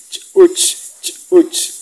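Chuch with a popped first ch, sound stopped before the vowel; glottalized CH. Shown as a demonstration. Teacher demonstrates CH with apostrophe, Chuch with stopped, popped onset. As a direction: make a little jump after the first ch in Chuch. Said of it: "es como parar el sonido".

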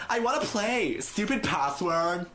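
high, whiny mock complaint; drawn-out goofy grumbling; silly put-on voice. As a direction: moan in a silly high voice, dragging the end. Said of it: "why did I do that voice?".

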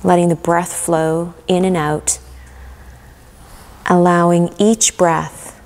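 calm guided meditation with long pauses. Acted. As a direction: guide them gently into relaxation, leaving room to breathe.

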